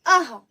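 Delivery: अह as short "aha"; half ह released after अ in अह; visarga breath. Shown as a demonstration. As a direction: model अह ending on a puff of h. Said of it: "कैसे?".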